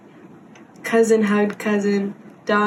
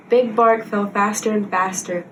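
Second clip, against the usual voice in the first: ordinary delivery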